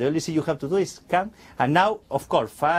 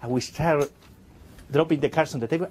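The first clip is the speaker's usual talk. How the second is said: ordinary talk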